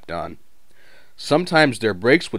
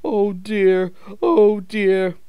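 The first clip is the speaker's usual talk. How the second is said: higher, louder self-pitying lament; sad; 8 semitones higher, 4 dB louder than usual. A narrator's reading. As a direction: moan about being shut away forever. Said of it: "he thought sadly".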